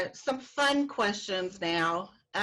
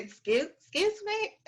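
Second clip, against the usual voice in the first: high, incredulous excuse me; shocked disbelief; 12 semitones higher than usual. Retold or imitated. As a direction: act floored by the complaint. Waitress shocked by customer complaint.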